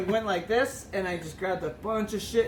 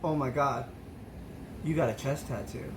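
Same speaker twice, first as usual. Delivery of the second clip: awed hush; 7 semitones lower, 7 dB quieter than usual. Retold or imitated. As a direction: murmur it like you can't believe your eyes. Drunk discovering a tattoo.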